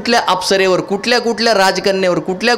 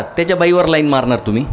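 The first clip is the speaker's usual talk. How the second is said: ordinary delivery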